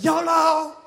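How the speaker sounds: excited mock whoop; thrill-seeker yell. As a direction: cheer it like you're jumping out of the plane. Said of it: "they're like".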